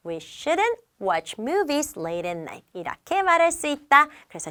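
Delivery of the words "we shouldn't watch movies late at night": You should save money spoken very slowly, word by word; slow teacher read-aloud; slow model sentence. Shown as a demonstration. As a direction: read it slowly for learners to copy. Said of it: "더 짧게 하고 싶으면".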